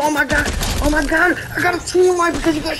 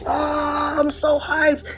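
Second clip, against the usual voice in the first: ordinary delivery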